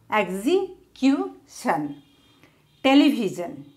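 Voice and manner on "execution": Execution slowly as exe-cu-tion; syllable by syllable. Shown as a demonstration. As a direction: pause before the last beats of Execution. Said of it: "how to divide words".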